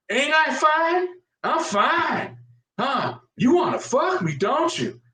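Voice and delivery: high-pitched mock come-on. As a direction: tease in a put-on tipsy woman's voice. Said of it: "she goes".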